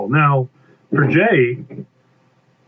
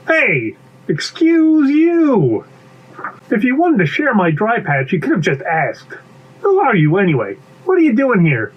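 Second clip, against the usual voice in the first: higher, affronted character voice; indignant bee; 8 semitones higher than usual. Acted. Bee challenges stranger on its dry patch, indignant. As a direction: draw out the offence, then question the intruder. Bee confronts intruder in story.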